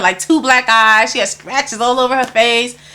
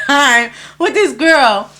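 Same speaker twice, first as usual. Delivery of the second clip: ordinary talk, nothing marked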